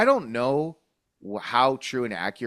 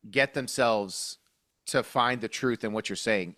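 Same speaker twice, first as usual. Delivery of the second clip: normal talking voice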